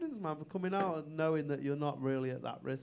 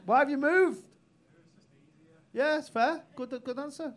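loud, high call across the room; 11 semitones higher, 4 dB louder than usual. Real, not acted. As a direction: shout it at the mover. Calling out to audience member.

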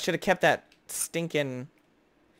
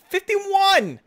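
high, loud triumphant yell; excited shout; 10 dB louder than usual. Real, not acted. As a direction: shout the number like a jackpot win. Reveals big count, yells.

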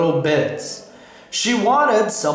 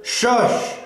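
mother's shush, slightly raised; hushing; 4 semitones higher than usual. A narrator's reading. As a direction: hush a noisy dog. Mom hushing the barking dog.

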